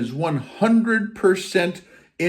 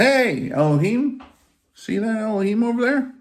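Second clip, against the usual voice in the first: higher-pitched, lightly voiced tempter; the serpent's tempting voice; 7 semitones higher than usual. Serpent pointing out someone else's job to the listener, lightly acted tempting. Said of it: "That's what the serpent did".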